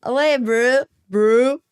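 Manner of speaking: put-on copycat South African accent; South African imitation, rising at the end; imitating South African slang. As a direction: echo a South African greeting. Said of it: "try an easier one".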